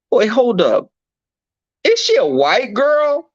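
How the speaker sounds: high, loud, stunned; shocked. Retold or imitated. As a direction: hold up in disbelief. Shocked realization on the phone.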